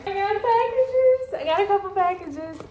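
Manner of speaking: real glee about packages; excited sing-song. Real, not acted. Arriving home to packages.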